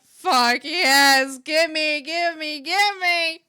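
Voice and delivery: loud, high demanding yell; greedy shout. Retold or imitated. Streamer acting her reaction to the podcast, greedy shout. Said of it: "I'm like".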